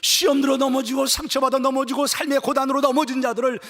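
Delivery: loud, driving chain of sustained phrases; fervent preaching. Real, not acted. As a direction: preach at full force, piling clause on clause.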